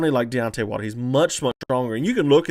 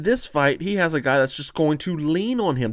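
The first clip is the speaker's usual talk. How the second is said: ordinary talk, nothing marked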